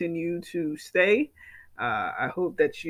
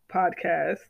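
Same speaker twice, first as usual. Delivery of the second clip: ordinary talk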